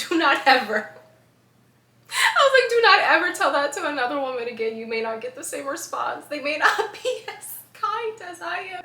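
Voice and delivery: loud, fast mock warning through laughs; half-joking telling-off; laughing scolding. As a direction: scold him while cracking up.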